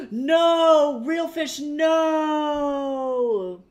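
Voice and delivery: gasp, then a high, loud, dismayed shout; stunned wail of no at a favourite shop closing; shocked outcry. Real, not acted. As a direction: cry out in real disbelief at the news.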